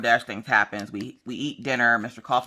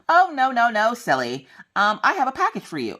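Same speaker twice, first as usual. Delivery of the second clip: high sing-song courier; 9 semitones higher, 3 dB louder than usual. Retold or imitated. Voicing the DoorDash woman.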